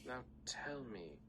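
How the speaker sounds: slow, menacing command; half dragon voice. Acted. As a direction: demand it with cold menace. Syndra demanding in dragon voice.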